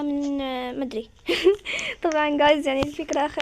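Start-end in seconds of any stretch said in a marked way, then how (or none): 1.3-2.0 laugh. Real, not acted. Laughs at her own remark.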